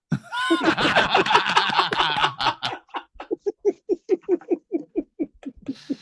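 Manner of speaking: big loud cackling laugh; loud high laugh. Real, not acted. Laughing hard at his own wife impression, loud and high.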